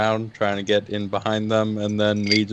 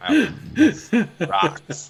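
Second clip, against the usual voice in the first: laughing, much higher pitch; 12 semitones higher, 3 dB louder, much slower than usual. Real, not acted. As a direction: squeal the words through laughter. Laughing at absurd grass question.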